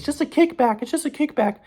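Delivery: high mock falsetto; imitating girlfriend. Girlfriend playing down the party, in high mocking voice. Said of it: "She says".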